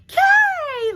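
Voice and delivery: sudden high shout; loud Amelita. Retold or imitated. Amelita yelling across an empty restaurant, loud high shout. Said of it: "So loud".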